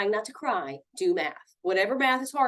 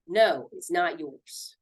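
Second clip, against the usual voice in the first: ordinary delivery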